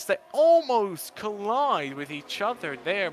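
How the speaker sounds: fast, high excited race call; excited commentary. Real, not acted. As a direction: call the overtake with rising excitement. Close battle for the lead.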